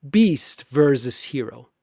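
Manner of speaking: ordinary delivery, nothing marked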